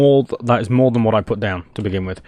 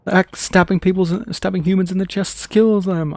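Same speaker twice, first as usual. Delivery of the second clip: higher, innocent matter-of-fact meme character; higher put-on cartoon voice; 8 semitones higher than usual. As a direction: say it like a naive cartoon killer. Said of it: "random knowledge of random meme".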